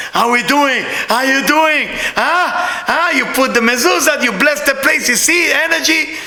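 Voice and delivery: eager, rising club-hype chatter; excited friend. Retold or imitated. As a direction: imitate a buddy buzzing with energy. Acts out friend checking on him.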